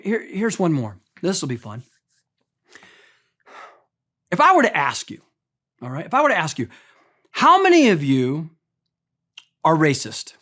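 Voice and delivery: normal talking voice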